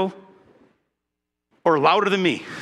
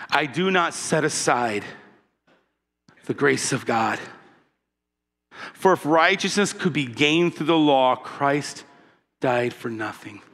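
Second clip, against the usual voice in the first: calm reading; 5 semitones lower than usual. Shown as a demonstration. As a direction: read it gently, almost hushed. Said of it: "I said that with a really calm voice".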